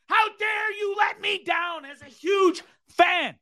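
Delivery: high, shouted outrage, dragging the words out; real fury at a sports hero who let him down; furious rant. Real, not acted. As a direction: yell it like a betrayed fan. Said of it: "I don't know if you could tell".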